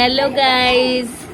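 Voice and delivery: put-on hello guys stretched out very slowly for the baby; slow coaxing hello guys; slow drawn-out prompt. Shown as a demonstration. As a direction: draw out hello guys so the baby can copy it. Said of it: "hello guys बोलते हैं".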